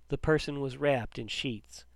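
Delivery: usual speaking voice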